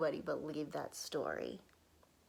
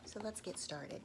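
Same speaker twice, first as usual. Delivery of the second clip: whispered narration. A narrator's reading. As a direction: whisper to draw children in.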